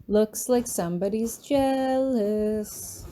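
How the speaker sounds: slow teasing sing-song lilt. Acted. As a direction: taunt him in a sing-song. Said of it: "I say in a sing-song voice".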